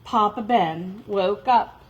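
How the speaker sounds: plain reading voice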